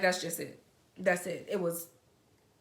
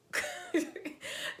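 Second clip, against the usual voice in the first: high unvoiced laugh; quick airy giggle; breathy laugh; 11 semitones higher than usual. Real, not acted. Laugh at vanished arm.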